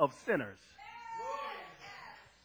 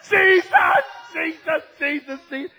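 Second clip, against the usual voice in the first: raised cry fading as it repeats; desperate calling; 7 semitones higher, 6 dB louder than usual. Acted. Sinner calling as the healer passes, desperate calling.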